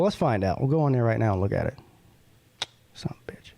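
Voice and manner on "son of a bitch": under-breath whispered curse; whispering. Real, not acted. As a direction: whisper the swear to yourself. Annoyed at screen, muttered curse.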